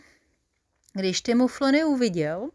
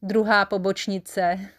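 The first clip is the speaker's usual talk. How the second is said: ordinary delivery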